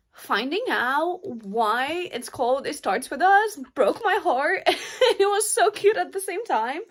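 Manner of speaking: high, loud voice breaking into teary laughter; choked up and laughing, pitch climbing; real tearful laugh. Real, not acted. Reader tells viewers the book broke her heart, tearful. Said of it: "verge of tears the whole time".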